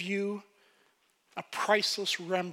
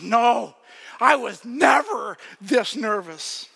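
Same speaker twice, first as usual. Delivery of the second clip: mock shout, louder and higher; retold shout; 3 semitones higher, 9 dB louder, slower than usual. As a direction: belt out the denial. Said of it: "And she goes, shout".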